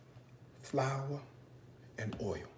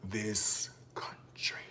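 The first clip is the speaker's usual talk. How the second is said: whispered, drawn-out emphasis; hushed awe; 6 semitones lower, 15 dB quieter, much slower than usual. Real, not acted. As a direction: drop to a slow, reverent whisper. Hushed emphasis on Midwest water praise.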